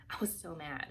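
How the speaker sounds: ordinary talk, nothing marked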